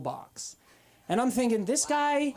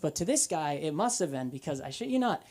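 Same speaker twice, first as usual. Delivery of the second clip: ordinary delivery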